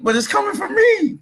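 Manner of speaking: high, loud cry of alarm; alarmed shout. Retold or imitated. To his brother on the phone, a high alarmed shout. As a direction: shout it up high, pleading to be believed.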